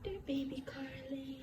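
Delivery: very soft, breath-only whisper. Real, not acted. Addressing baby Carly, whispered. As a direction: whisper it tenderly to a newborn. Hushed words near the newborn.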